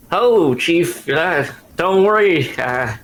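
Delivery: soothing nasal creature voice; nasally voice. Acted. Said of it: "nasally voice".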